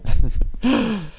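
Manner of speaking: quick high burst of laughter; short laugh. Real, not acted. Teacher laughs after thanking Jason, short amused laugh. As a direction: laugh at the joke. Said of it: "saying that telepathically to my head".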